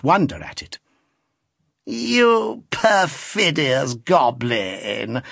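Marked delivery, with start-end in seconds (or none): 1.8-5.2 put-on very slow, coy scolding old lady; mock-scold him, lingering on every word; an old lady's languid, teasing rebuke; affected old lady. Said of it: "said the lady in the chair".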